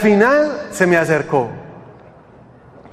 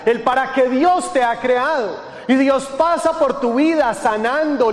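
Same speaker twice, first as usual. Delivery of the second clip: high, driving preacher's delivery; raised-pitch sermon cadence, falling at each clause end; forceful preaching; 5 semitones higher than usual. Acted. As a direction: press each point home to the congregation.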